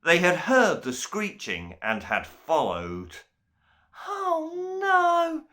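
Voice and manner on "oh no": high, crying little voice; frightened gasp while fleeing; panting cry. A narrator's reading. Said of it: "panted Milktooth".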